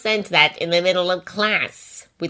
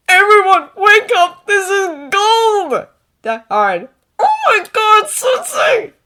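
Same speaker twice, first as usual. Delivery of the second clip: excited shout; 8 dB louder than usual. Acted. Wakes the group with news.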